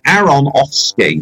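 "Aronofsky" said slowly, each syllable spelled out: a-ro-NOF-sky; slow careful naming. Shown as a demonstration. Coaching a friend on the name, slow and careful. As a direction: sound out "Aronofsky" slowly so the F is heard. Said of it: "There's an O and an F".

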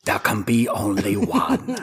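slow, strained put-on warrior declaration; drawn-out mock-heroic proclamation; mock epic movie quote. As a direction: intone it slowly like a hammy action hero.

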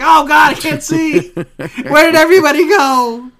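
very high, loud hammed-up panic; mock panicked shout. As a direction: cry out as if suddenly blinded.

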